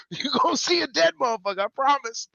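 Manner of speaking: high-pitched joking menace; mock threat. Co-host imagining his answer to the driver, high mock threat. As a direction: promise trouble, playing it for laughs.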